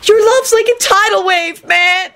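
very high-pitched screeching. A narrator's reading. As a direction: screech it like an overwrought suitor. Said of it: "Kenny screeched".

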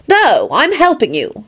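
high, slow, stubborn refusal; Elizabeth, stubborn. A narrator's reading. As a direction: dig your heels in, drawing it out. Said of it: "Elizabeth replied stubbornly".

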